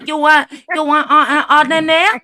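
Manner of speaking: put-on loud Chinese-accented nail tech; mock Chinese accent. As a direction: order a client about in a put-on Chinese accent. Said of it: "she does a perfect Chinese person".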